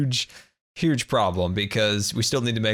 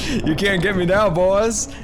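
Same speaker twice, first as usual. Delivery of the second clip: taunting; 7 semitones higher, 5 dB louder, slower than usual. Real, not acted. Taunting fish with milk.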